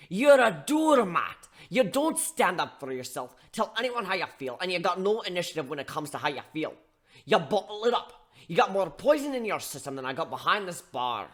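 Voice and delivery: loud, high barman dressing him down; scolding bartender. Acted. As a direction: tear into him like a fed-up mentor. Steelhorn scolding the narrator.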